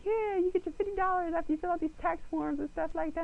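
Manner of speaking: high, chirpy official voice; imitating officials. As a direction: rattle off like a cheery clerk. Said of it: "And they're like".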